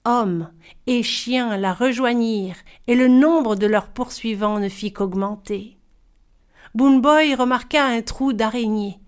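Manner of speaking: plain reading voice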